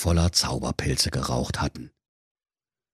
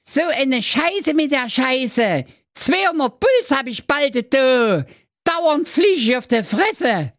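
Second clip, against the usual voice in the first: calling out. Acted. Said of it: "hörte man ihn rufen".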